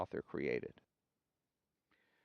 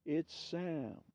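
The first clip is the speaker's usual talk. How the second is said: distant voice, higher and softer; insisting voice; 6 semitones higher, 5 dB quieter than usual. A narrator's reading. Dead friend insisting to Mo, soft distant voice. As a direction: quietly insist from far away. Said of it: "insists the voice".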